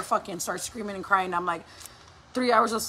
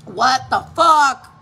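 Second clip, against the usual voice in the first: loud, drawn-out disbelief; exasperated outburst; 3 semitones higher, 13 dB louder, much slower than usual. Retold or imitated. Mom in her head at the toilet paper mess, exasperated. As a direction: stretch each word slowly and loudly in exasperation.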